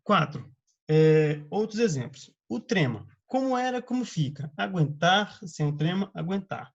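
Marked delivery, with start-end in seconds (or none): none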